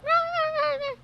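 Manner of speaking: squeaky high rah rah; mock nagging. Mocking the woman's complaint, high squeaky yapping. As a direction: yap like a scold. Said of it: "She was just like".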